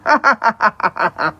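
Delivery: mocking, evenly spaced ha-ha-ha; scornful laugh. Real, not acted. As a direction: laugh right back at the heckler. Scornful comeback to an insulting commenter.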